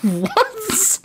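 high disbelieving what; incredulous. Real, not acted. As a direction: squeak it in disbelief.

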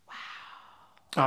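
hushed, breathless "Wow" after a gasp; gasp, then breathe out the wonder; a retold gasp of amazement; awed gasp. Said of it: "I was like".

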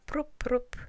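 high popping sound effect; two quick imitated plucks; popping noise. A narrator's reading. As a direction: pop out each sound. Sound of sticks being pulled out.

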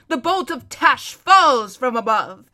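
loud, drawn-out war cry; dramatic battle cry. A narrator's reading. Rapidash leaping down on his enemy, crying out grandly.

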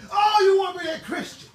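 loud preacher's challenge; preaching shout. Real, not acted. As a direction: hit it hard at the crowd. Preacher calling out the congregation.

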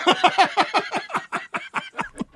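very high squealing laugh. Real, not acted. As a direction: laugh helplessly. Laughing at own story. (甲高い笑い)

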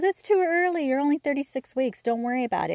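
mom shooing away the worry; dismissive mom. Retold or imitated. Mother brushing off daughter's broken water, high and dismissive. Mom insisting it's too early.

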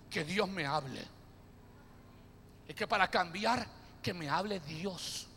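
mock someone demanding a sign; understated sulky mutter; muttering doubter. Mocking doubter.

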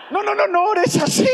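high-pitched panicked protest, hammed up; mock alarm. Young person stopping the pastor's harsh prayer, mock panic. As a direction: cut in with comic dread.

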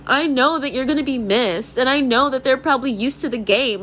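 ordinary talk

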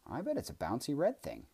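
high and eager guess; excited. A narrator's reading. Kellen guessing at the ocean with Boojum, excited. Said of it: "equally excited".

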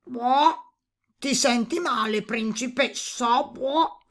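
high, ungainly croaking toad. A narrator's reading. Toad asking a weeping princess if she is unwell, ungainly voice. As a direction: put on an ungraceful toad voice with croaks. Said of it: "una voce sgraziata".

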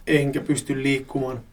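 ordinary delivery

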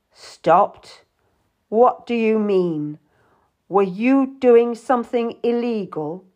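cold, pointed questioning; icy. A narrator's reading. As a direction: question her with icy suspicion. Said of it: "His voice was like ice".

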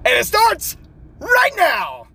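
shouted high intro call; hype shout. Acted.

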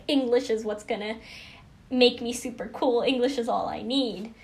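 ordinary delivery

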